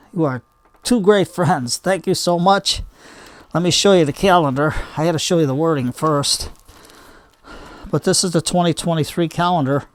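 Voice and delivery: ordinary talk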